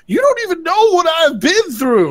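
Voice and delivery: hammed-up Jon venting at her; exasperated Jon. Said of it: "It's like".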